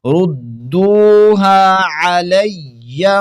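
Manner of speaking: slow, drawn-out melodic tilawat; Quran recitation. Acted. Recites the verse. (ترتیل کے ساتھ تلاوت)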